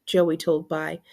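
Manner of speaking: plain reading voice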